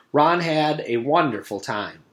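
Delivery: ordinary delivery, nothing marked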